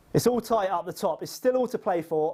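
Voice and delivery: normal talking voice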